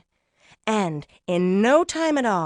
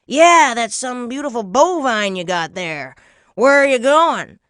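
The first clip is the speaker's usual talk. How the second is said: put-on loud old-timer character voice; booming, folksy old stranger; strange old man; 10 dB louder than usual. Old stranger hailing Jack.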